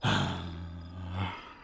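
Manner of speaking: exaggerated disappointed sigh; theatrical sigh; mock sigh. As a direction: sigh like he is let down. Mock disappointment at her answer.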